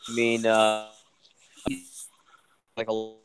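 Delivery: audio dropping out mid-sentence; bad connection. Real, not acted. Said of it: "Oh, you're breaking up again".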